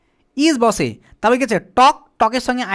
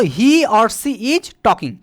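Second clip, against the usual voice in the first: slow modelling; much slower than usual. Shown as a demonstration. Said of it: "teacher slowly modelling sentence".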